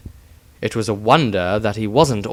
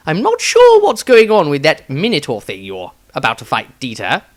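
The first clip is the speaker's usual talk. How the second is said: a touch higher and louder wizard; Maestro's voice; 5 semitones higher, 5 dB louder than usual. Acted. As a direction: raise a curious warning. Maestro speaking.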